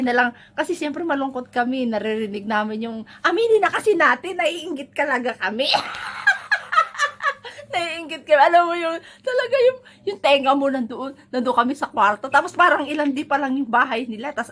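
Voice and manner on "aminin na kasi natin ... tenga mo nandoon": laughing confession. Real, not acted. Admitting envy, laughing.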